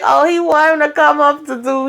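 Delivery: mock him, tongue sticking out. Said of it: "making his tongue go out like".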